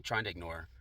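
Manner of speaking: usual speaking voice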